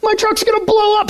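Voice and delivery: high alarmed rush; imitating his own panic that the truck will explode; panicked. Panicked thought, truck smoking.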